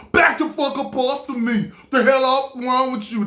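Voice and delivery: imitated angry New York woman; New York attitude. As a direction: tell off a stranger like a tough New Yorker. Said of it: "that New York came after her".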